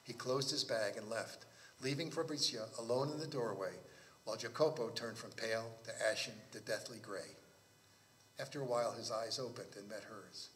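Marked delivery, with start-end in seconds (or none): none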